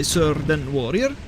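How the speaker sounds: ordinary delivery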